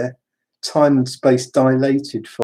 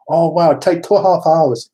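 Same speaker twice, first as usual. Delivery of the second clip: higher, louder mock-Japanese voice; put-on Japanese accent; 5 semitones higher, 5 dB louder than usual. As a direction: play the midwife in an exaggerated Japanese accent. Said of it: "have to do the accent".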